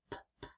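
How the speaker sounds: p, p as soft voiceless puffs; demonstrating voiceless p; unvoiced p. Shown as a demonstration. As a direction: puff the p with no voice. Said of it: "is unvoiced".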